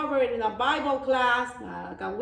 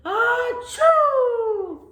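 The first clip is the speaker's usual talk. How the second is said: high, loud acted sneeze; big sneeze; 12 semitones higher, 8 dB louder than usual. Goat sneezing at a bee, high and loud. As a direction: let a huge sneeze burst out. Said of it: "he sneezes".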